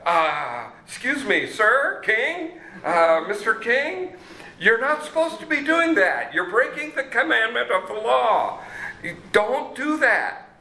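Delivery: high, hesitant, overly polite pleading; mock-timid priest voice, slowing at the end; timid, deferential priests. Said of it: "You know, you say".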